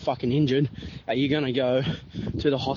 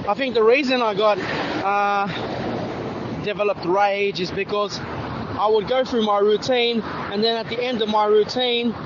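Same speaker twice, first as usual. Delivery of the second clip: higher, louder, agitated rant; worked-up retelling of a maddening shift; frustrated venting; 7 semitones higher, 3 dB louder than usual. Real, not acted. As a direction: still fuming as you tell it. Agitated venting about rage at work.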